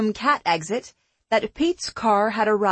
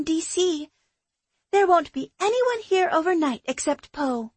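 higher, bright friend's voice; young woman voice; 6 semitones higher than usual. Acted. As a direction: voice her as an eager young woman. Shannon's dialogue, higher voice.

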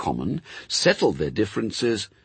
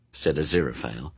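usual speaking voice

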